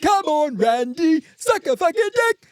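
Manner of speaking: high, loud jeering yell; mock English heckler. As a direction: heckle the player like a drunk fan.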